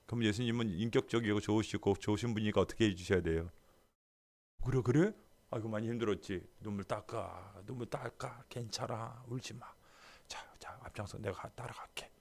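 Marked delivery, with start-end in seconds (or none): 4.6-9.7 soft, soothing words to a crying woman; tender comfort. Pretend. 10.3-12.1 whisper. Pretend. Jesus whispering reassurance.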